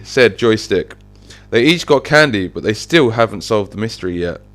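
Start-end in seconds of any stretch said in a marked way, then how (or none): none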